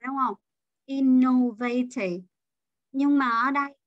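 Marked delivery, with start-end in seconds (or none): 0.8-2.2 innovative said slowly as in-no-VAY-tive; innovative, drawn out with misplaced third-syllable stress. Retold or imitated. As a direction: imitate the mistake, innovative with a long VAY. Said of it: "ở âm thứ ba".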